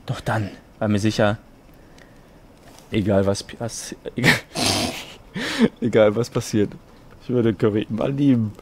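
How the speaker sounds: low, hushed, solemn reading; mock-earnest murmured narration; romantic story narration. Reads fanfiction aloud.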